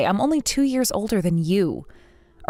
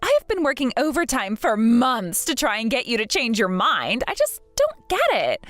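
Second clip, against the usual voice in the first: put-on higher, sneering mean-girl voice; bratty younger sister; 3 semitones higher than usual. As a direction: look down on her and sneer, pitched up. Cassie taunts her brother's fiancée.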